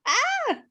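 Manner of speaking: high-pitched cry to shake off tears; fighting back tears. Real, not acted. Speaker steadying herself before the group, fighting back tears. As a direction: let out a high "ah" to stop yourself crying. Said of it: "okay, I'm not gonna cry".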